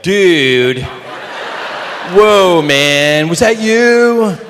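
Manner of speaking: shouted surfer-dude voice, drawn out; loud American dude. Retold or imitated. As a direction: bellow it like a stoner dude. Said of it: "Not like here in America".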